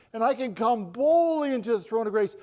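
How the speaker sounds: loud, high, full-force preaching; bold proclamation. Real, not acted. Preacher proclaiming access to the throne of grace, boldly. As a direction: ring out "boldly" with conviction.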